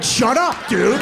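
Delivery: high, loud snap at the brother; annoyed little brother yelling back; angry kid yell. Retold or imitated. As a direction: shout him down.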